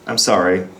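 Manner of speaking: usual speaking voice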